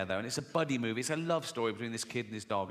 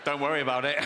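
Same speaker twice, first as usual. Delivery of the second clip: smug, louder reassurance; 5 dB louder, faster than usual. Acted. Smug goldfish to panicking passengers in the water, smug. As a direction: brush it off smugly as the goldfish. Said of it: "he's all smug then".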